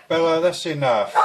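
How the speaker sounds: loud, stern call to the dog; calling out sharply. Real, not acted. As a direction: snap at the dog to stop. Telling off the barking dog.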